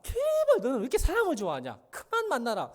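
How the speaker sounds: high, loud imitation of a pleading dad; exasperated father. Father begging his son to stop seeing friends, exasperated. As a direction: plead loudly, pitch jumping up.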